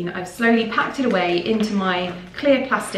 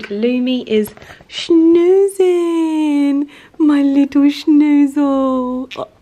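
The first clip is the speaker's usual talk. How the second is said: high sing-song pet talk, much higher and louder; 6 semitones higher, 8 dB louder, much slower than usual. Real, not acted. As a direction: coo over the sleeping cat. Cooing to her sleeping pet.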